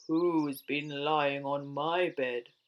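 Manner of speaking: low, loud, slow boom; gruff Father Bear. A narrator's reading. Big Father Bear finding his bed used, gruff boom. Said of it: "in his great gruff voice".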